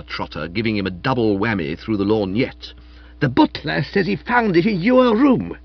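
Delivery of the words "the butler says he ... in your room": higher, louder accusation; accusing. Acted. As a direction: confront him with it. Accusing the butler.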